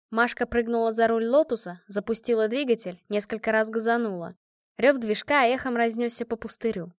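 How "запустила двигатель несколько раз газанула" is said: ordinary delivery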